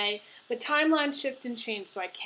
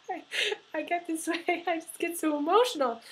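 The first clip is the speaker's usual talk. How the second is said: laughing through tears, voice pitched up; choked-up, teary giggle between words; crying; 7 semitones higher than usual. Real, not acted. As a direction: overcome with emotion mid-reading. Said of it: "It's gonna make me cry".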